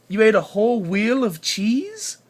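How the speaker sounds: loud, incredulous question; surprised. Pretend. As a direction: act shocked at the baby. Mock shock at baby's babble.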